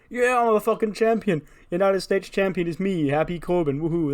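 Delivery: high, loud, giddy champion bragging; excited Corbin boast. Retold or imitated. Corbin crowing to Kayla about his title win, giddy and loud.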